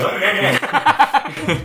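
drawn-out laughing no; laughing it off slowly. Real, not acted. Denying while laughing.

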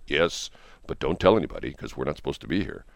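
hushed aside to the worker; confiding. Acted. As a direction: keep it down, as if letting someone in on a secret. Limo driver confides in worker.

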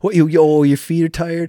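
high, loud, sneering mock sympathy; scoffing at his own tired legs, loud and high; mocking taunt. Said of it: "I'm like".

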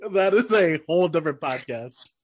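ordinary talk, nothing marked

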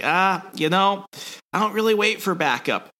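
lightly voiced swaggering hero; cocky brush-off. Valance brushes off Boba.

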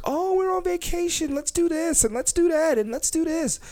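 high-pitched mimicry of eager girls; mock excited girl. Host mocking girls arriving on vacation, high excited voice. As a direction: pitch it way up, gushing in mockery.

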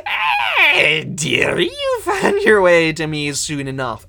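high, loud, shrieking creepy creature; gleeful monster greeting. Acted. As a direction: screech it like a grinning monster. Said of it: "grins at you and says".

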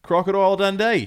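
high, loud Aussie twang; Australian accent. Pretend. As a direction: announce it like an Aussie bloke. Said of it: "you just went to the Australian accent".

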